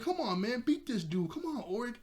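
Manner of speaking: high-pitched urging, little extra volume; mock yelling. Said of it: "everybody keeps spazzing at him".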